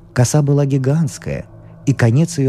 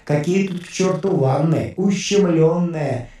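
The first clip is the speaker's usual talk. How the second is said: demonic voice of fear; 5 semitones higher than usual. A narrator's reading. Fear sings to the young doctor in a demonic voice. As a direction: sing out like a taunting demon. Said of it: "Демонским голосом пел страх".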